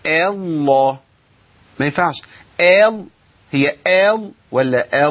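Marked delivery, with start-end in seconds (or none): 0.1-1.0 الله with the lam thinned; imitated wrong reading. Teacher imitating a student's thin lam in الله. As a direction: mimic someone thinning the lam. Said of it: "زي اللي بيقول".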